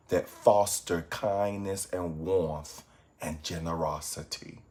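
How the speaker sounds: soft, unhurried meditation voice with long pauses; guided meditation. Acted. Meditation teacher guiding evening viewers to reflect on goodness, guided meditation. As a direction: guide them gently into reflection, low-key and calm.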